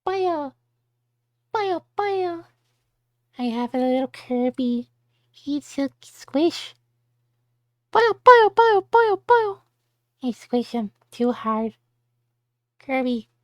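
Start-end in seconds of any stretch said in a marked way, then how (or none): 0.0-2.4 high squeaky cartoon creature babble; Kirby noises. Streamer showing off a Kirby plush, high Kirby babble. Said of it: "Just making Kirby noises". 7.9-9.5 very high, loud creature squealing; loud Kirby squeals. Retold or imitated. Squeezing the Kirby plush, loud high Kirby squeals. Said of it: "Just making Kirby noises".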